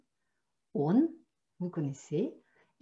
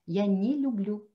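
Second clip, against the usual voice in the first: normal talking voice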